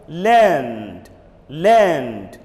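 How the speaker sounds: "learned" ending in D, one syllable; model pronunciation. Shown as a demonstration. Teacher tells class to listen, then models it. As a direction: drill "learned" with a clear D. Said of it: "Listen to this".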